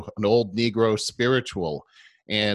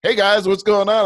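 much higher, louder cheerful hello; eager greeting; 10 semitones higher, 9 dB louder than usual. Retold or imitated. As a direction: call out hopefully to new classmates. Retold greeting to college peers.

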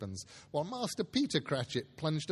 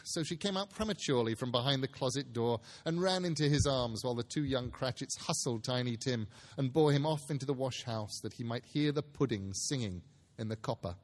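usual speaking voice

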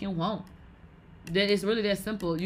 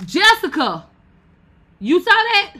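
loud, high excited shout; excited call; 10 semitones higher, 11 dB louder, slower than usual. Real, not acted. Calling out to Jessica about the argument, excited shout. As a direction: yell it out thrilled.

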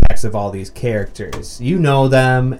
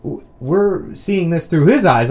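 ordinary talk, nothing marked

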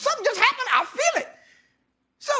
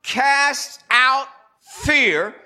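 preaching scripture; 8 semitones higher, 8 dB louder, slower than usual. Acted. Returns to scripture cadence.

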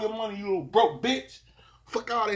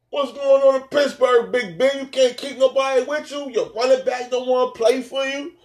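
raised, high-pitched challenge; calling out; 5 semitones higher, 3 dB louder than usual. Real, not acted. Podcaster to Big Ben about his team, calling out. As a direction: demand answers, pitched up high.

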